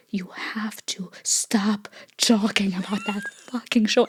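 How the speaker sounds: whispered, slow, hushed retelling of a friend's outburst; retold hushed outburst. S0 retelling her best friend snapping about House, hushed whisper. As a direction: hiss it low, like reliving her snapping.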